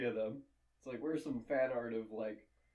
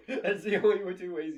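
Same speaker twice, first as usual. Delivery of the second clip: laughing; 5 semitones higher, 6 dB louder than usual. Real, not acted. Laughing while explaining.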